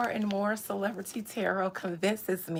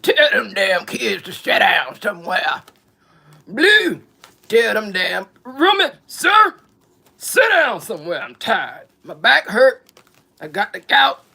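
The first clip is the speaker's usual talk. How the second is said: put-on loud, cranky old man yelling; gout-stricken old man yelling at the kids; ornery old man; 12 dB louder than usual. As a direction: holler like a worn-out grumpy grandpa. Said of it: "old and ornery".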